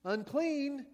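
high, loud cry of unclean; leper calling out. Retold or imitated. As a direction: warn the crowd as you approach. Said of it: "he probably said".

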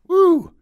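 high excited whoop. Real, not acted. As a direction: cheer with a loud high woo. Cheering the guest.

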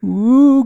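high, loud wavering ghost moan; spooky ghost ooh. Pretend. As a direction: moan it like a haunting spirit. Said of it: "ghost noise".

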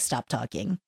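low, quiet deadpan; deadpan shutdown. Retold or imitated. Friend shutting down her rants, low deadpan. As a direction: cut the rant off quietly and low.

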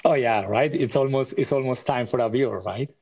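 usual speaking voice

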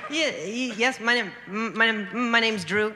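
repeated stuttered starts. Real, not acted. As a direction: stammer on each first word. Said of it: "I have a stutter".

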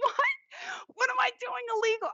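high, fast, cracking cry of disbelief; shocked disbelief. Retold or imitated. Arrested for writing on steps, a high shocked squeal. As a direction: squeal it out like you can't believe you're being cuffed.

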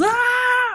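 high mock scream of fright; retold scream. Bran screaming as the Night's King grabs him, high mock scream. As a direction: yelp it out like a startled boy. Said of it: "Bran screams and he reaches".

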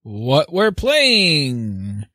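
usual speaking voice

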